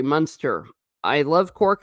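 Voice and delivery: ordinary talk, nothing marked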